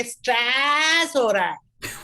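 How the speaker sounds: slow, stretched-out Punjabi matriarch catchphrase; theatrical old B G drawl; stage B G catchphrase. Shown as a demonstration. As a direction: drag the first word right out. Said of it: "I would go like this and say".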